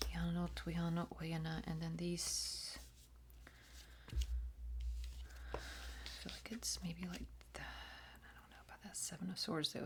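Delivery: soft, secret ritual whisper; hushed incantation; whispered invocation. Acted. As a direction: breathe the names like a spell.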